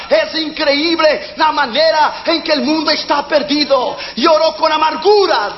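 fiery preacher hammering each point; full-force preaching. Acted. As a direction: preach it out hard, shouting the commands.

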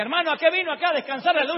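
high, loud, indignant worker's yell; yelling electrician. Retold or imitated. Electrician protests to the preacher, loud indignant yell. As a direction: shout it up like a fed-up electrician.

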